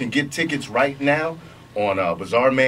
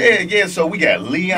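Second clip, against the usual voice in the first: louder, higher, eager; excited; 8 semitones higher, 4 dB louder than usual. Real, not acted. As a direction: jump in eagerly. Eager to list cast.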